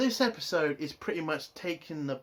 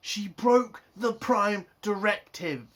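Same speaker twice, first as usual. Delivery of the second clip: loud, higher and slow "broke" hammered home; outraged insistence; 4 semitones higher, 6 dB louder, slower than usual. Real, not acted. Reviewer insists to viewers the rule was broken, slow loud outrage. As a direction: spell it out slowly, furious.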